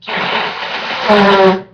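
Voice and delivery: loud honk into a bandana; blowing nose. Real, not acted. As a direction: blow the nose hard, grunting. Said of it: "I gotta blow my nose".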